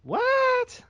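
very high, loud shocked yelp. Real, not acted. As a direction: yelp it in disbelief. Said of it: "I'm a little shocked".

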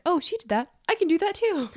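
high, loud, excited boast; mock eager one-upping. Podcaster voices Ezra out-boasting his friend, eager and high. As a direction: pipe up like a competitive sibling. Voicing Ezra's competitive boast.

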